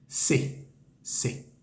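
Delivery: se whispered softly, short open uh vowel; quiet demo of se; French pronunciation. Shown as a demonstration. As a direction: breathe se lightly, uh sound. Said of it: "que se dice".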